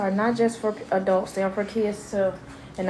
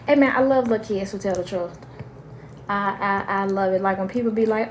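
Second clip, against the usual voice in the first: usual speaking voice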